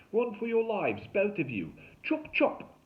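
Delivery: loud, high survivalist holler; frantic fake Aussie. A narrator's reading. Derek up the tree urging kids to run, frantic holler. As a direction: order them away in a hammed-up Australian voice.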